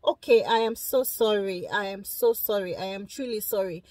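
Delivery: heartfelt, quiet sorry set against a careless one; sincere apology. Shown as a demonstration. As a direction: apologise like you really mean it. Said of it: "there is difference between".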